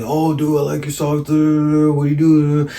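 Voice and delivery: mock him rambling on in a slurred drone; whiny mocking imitation; pestering guy.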